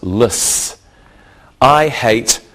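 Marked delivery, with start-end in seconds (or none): 0.0-0.8 "liss" as one short syllable ending in S; suffix pronunciation. Shown as a demonstration. Teacher showing students the L E S S ending, "liss" as a short syllable. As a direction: say "liss" as an unstressed word ending. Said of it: "which is pronounced".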